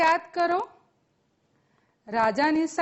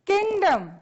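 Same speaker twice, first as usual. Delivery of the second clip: raised, higher call for repeating; loud model word; 5 semitones higher than usual. Shown as a demonstration. Teacher leading students in loud repetition, called out. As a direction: call the word out for a class to echo. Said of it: "મોટેથી બોલજો".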